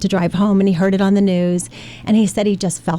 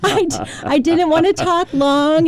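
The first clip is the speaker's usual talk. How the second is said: laughing; 9 semitones higher than usual. Real, not acted.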